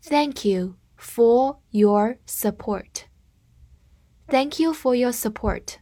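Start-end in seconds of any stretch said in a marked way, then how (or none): 0.0-3.1 very slow, careful model sentence; slow reading. Shown as a demonstration. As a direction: read it slowly for students to repeat. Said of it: "跟着我慢读一遍". (慢速示范朗读)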